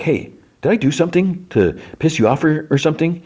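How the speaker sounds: high, whiny put-upon question; whiny mock complaint. Recapper voicing Jonathan confronting Nancy, high whiny mock hurt. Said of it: "He's like".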